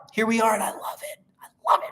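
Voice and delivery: hushed airy whisper. Acted. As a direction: whisper it playfully. Whispered after loud.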